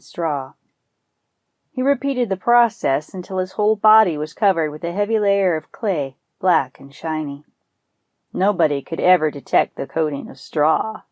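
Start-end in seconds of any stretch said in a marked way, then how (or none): none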